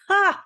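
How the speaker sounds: a single high, loud mock laugh; one sharp high "Ha!", hammed up like the queen. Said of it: "She starts to laugh at her own joke".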